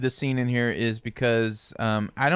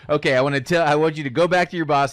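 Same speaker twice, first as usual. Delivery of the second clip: quick stern order; 6 semitones higher, 5 dB louder, faster than usual. Retold or imitated. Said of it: "he's like".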